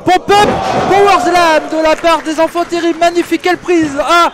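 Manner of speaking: loud, high excited ring call; wrestling commentator. Real, not acted. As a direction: call the move like a ringside announcer.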